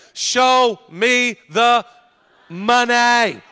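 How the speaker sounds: very slow, word-by-word delivery; drawn-out hype. Acted. Preacher telling of the couple's windfall, hammed-up drawn-out hype. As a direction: stretch every word, milking "money".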